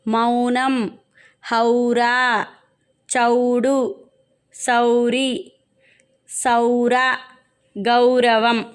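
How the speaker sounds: word list with each first syllable held on "au" as in "పౌరుడు"; au-sound demonstration. Shown as a demonstration. Teacher showing pupils words with ఔత్వం, drawn-out demonstration. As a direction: stretch the gliding "au" opening "గౌను", "నౌక". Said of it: "ఔ ధ్వనించేలా పలకాలి".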